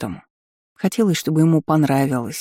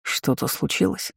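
wary; 6 dB quieter than usual. A narrator's reading. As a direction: ask it cautiously, softly. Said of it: "Чешуйчатый сразу насторожился".

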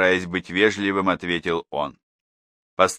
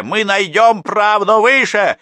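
much higher, louder, slow defiant cry; defiant vow; 13 semitones higher, 9 dB louder, slower than usual. A narrator's reading. Mother vows to take it higher, loud and defiant. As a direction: swear to go over their heads.

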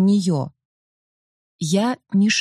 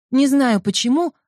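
usual speaking voice

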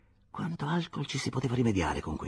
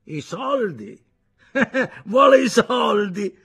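high, loud, slow words broken by laughter; drunken mocking cackle; 12 semitones higher, 12 dB louder, slower than usual. A narrator's reading. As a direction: laugh in his face at the money demand. Drunk foreman mocking the victim.

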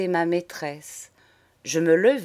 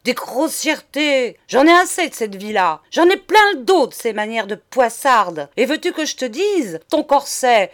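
raised, loud exasperated tirade; angry outcry; 4 semitones higher, 6 dB louder than usual. A narrator's reading. Monsieur losing his temper with madame, angry rant. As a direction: explode after holding it in. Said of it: "s'encoléra ... Et il s'écria".

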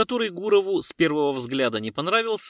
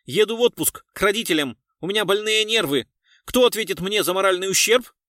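high, loud indignant protest; 6 semitones higher, 6 dB louder than usual. A narrator's reading. Culture worker on holiday demands compensation, indignant. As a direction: demand redress in an outraged tone. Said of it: "негодующе ответил господин".

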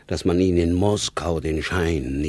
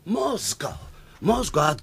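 excited cry; 9 semitones higher than usual. Acted. Director triumphantly shows telegram.

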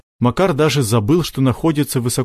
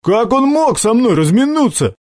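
high, whiny disbelief; whining; 11 semitones higher, 3 dB louder than usual. A narrator's reading. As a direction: complain like a scolded underling. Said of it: "заныл Сашок".

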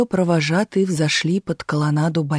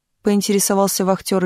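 ordinary delivery, nothing marked

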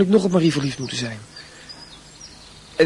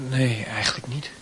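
breathy half-whisper, slow and hesitant; whispered admission; 5 semitones lower, 6 dB quieter than usual. Acted. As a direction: admit it under your breath. Reluctant admission to his mother. (fluisterend, aarzelend toegegeven)

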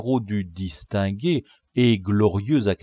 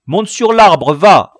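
high, loud command; urgent boyish command; shouted order; 9 semitones higher, 14 dB louder than usual. A narrator's reading. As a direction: order him up, voice raised. Chief orders boy up the tree.

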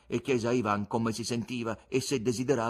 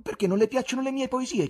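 high, louder curious question; 6 dB louder, faster than usual. A narrator's reading. Ivan asking the guest about his poems, keenly curious. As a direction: ask eagerly, pitched well up. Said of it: "chiese Ivan con curiosità".

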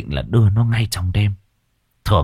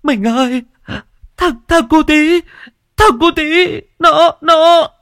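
high, loud, broken wail; sobbing, choked with tears; 7 dB louder than usual. A narrator's reading. Wife bringing terrible news to husband, sobbing. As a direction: stammer through sobs, very high-pitched. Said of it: "vừa khóc vừa nói trong tiếng nấc nghẹn ngào".

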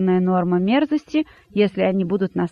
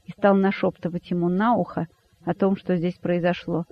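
usual speaking voice

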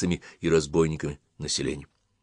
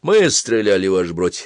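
usual speaking voice

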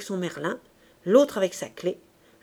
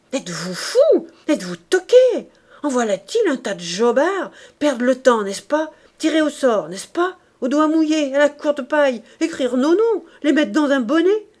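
loud, higher scornful tirade; mock their foolishness loudly; exasperated outburst; 5 semitones higher, 8 dB louder than usual. A narrator's reading. Thénardier scolding the bandits for wasting time, loud scorn. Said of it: "Thénardier s'exclama".

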